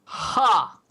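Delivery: loud mocking bark of a laugh; dwarf guard scoffs at the stranger; scoffing ha.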